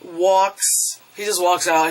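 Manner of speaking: usual speaking voice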